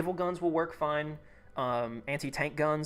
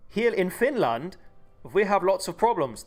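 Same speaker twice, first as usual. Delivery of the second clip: loud, raised, drawn-out attempt at a Finnish accent; awful Finnish accent; 4 semitones higher, 7 dB louder than usual. Streamer voices Finland listing its tank problems, awful Finnish accent. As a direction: put on a bad, hammy Finnish accent. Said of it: "That was an awful accent".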